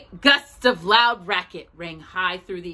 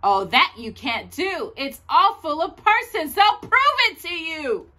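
higher, urgent pleading; desperate objection from a storybook elephant; alarmed protest; 7 semitones higher than usual. A narrator's reading. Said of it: "gasped Horton".

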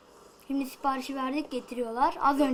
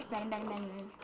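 much softer, mumbled mine mine; muttered to self; 4 semitones lower, 12 dB quieter, faster than usual. Real, not acted. Gamer grabbing items, mumbling mine. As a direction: say it under your breath.

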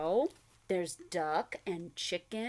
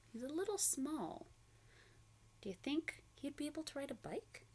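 ordinary delivery

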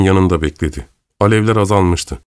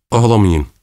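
ordinary delivery

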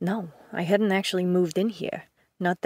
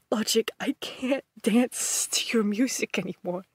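sighing, whispered confession; broken whisper; 4 semitones higher than usual. Acted. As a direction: let the voice collapse into a whisper. Broken confession after outburst.

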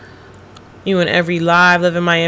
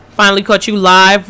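normal talking voice

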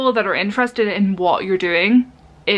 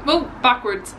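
ordinary delivery

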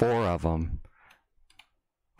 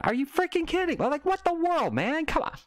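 very high-pitched, incredulous rant; exasperated disbelief. Acted. Driver ranting at phone users, exasperated disbelief. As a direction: squeal it in exasperation at their nonsense.

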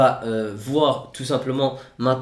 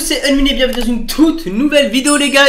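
hyped intro; 12 semitones higher, 10 dB louder than usual. Real, not acted. Hyped vlog intro shout.

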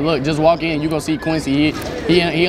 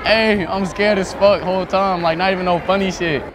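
usual speaking voice

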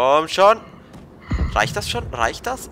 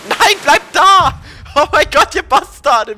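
loud frantic yell; shouting; 10 semitones higher, 7 dB louder, slower than usual. Real, not acted. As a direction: shout after the leaving ship. Left behind by ship.

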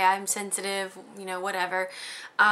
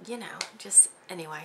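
whispered, much softer, fading; whispered trail-off; 13 dB quieter than usual. Real, not acted. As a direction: let it dissolve to a whisper. Trailing off at the end.

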